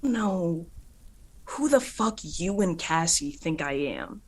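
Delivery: loud, heated tirade; angry outburst. Acted. Luna refuses to fight a downed Aster, loud angry rant. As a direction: spit it out, furious and proud. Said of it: "and she says".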